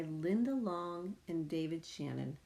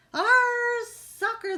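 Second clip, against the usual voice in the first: much higher, louder pirate shout; crew yelling; 10 dB louder, much slower than usual. Acted. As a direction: yell it as the whole crew. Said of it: "the crew yelled".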